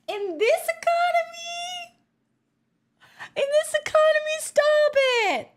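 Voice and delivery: high, giddy squeal; thrilled she can't believe it; gleeful disbelief. Real, not acted. Streamer gushing about a generous gift, high giddy squeal.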